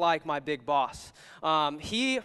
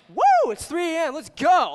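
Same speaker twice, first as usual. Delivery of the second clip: high, loud fake hype at 3 a.m; mock excitement; 10 semitones higher, 9 dB louder than usual. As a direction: cheer like you're thrilled to wake up. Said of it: "I'm not like".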